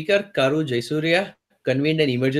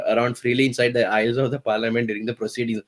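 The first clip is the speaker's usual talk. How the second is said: usual speaking voice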